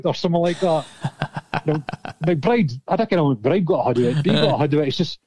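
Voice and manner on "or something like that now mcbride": ordinary talk, nothing marked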